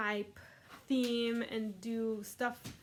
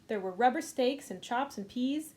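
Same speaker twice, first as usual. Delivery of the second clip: ordinary talk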